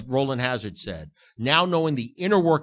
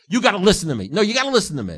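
a loud, mock demand to be heard; 5 semitones higher, 6 dB louder, faster than usual. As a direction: insist loudly that they listen.

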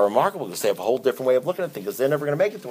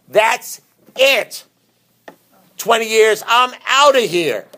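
higher and louder mock exasperated walkout; higher, louder fed-up spouse imitation; mock outburst; 10 semitones higher, 5 dB louder, slower than usual. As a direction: play the fed-up spouse storming out. Client walking out, quoted.

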